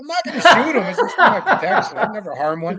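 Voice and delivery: high, loud, laughing through the words; laughing reassurance. Retold or imitated. Teller reassures Darcy, laughing.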